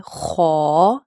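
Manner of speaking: خو thick and rounded, held long; long, full-mouthed خو; bold letter. Shown as a demonstration. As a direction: give خو a heavy "khaw" sound. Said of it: "bold پڑھا جاتا ہے".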